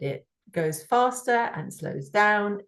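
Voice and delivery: usual speaking voice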